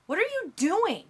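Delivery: high-pitched indignant question; startled, voice leaping high; startled protest. A narrator's reading. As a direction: demand an explanation after being shoved. Just pushed over, protesting.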